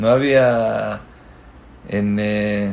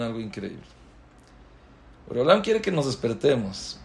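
normal talking voice

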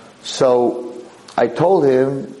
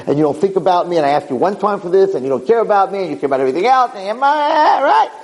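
mock angry husband, pitch climbing higher and higher; retold screaming complaint; 8 semitones higher than usual. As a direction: list the grievances, building toward a shriek. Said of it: "He's screaming at her".

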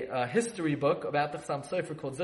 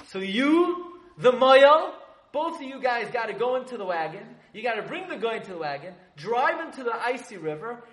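urgent instruction; 7 semitones higher than usual. Retold or imitated. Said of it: "Chassam Sofer was very upset".

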